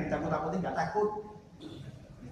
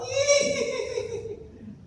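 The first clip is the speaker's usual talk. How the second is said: high-pitched mock ghost laugh; horror-film ghost cackle. Sound heard passing a grave, imitated ghost cackle. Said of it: "Lewat makam ada suara".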